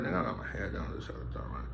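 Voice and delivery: unvoiced whisper, head hung low. Telling friends about his hurt pride, whispered. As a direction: whisper it like you're ashamed.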